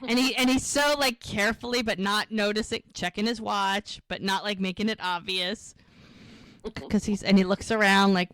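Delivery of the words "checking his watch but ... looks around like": ordinary delivery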